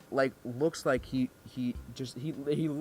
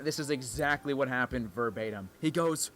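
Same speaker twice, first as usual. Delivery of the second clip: usual speaking voice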